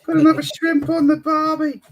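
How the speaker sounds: put-on a truly atrocious Aussie accent. Mocking the tourist phrase in an overblown Aussie accent. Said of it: "a truly atrocious attempt at an Aussie accent".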